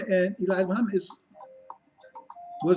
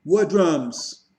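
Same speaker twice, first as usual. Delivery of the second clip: normal talking voice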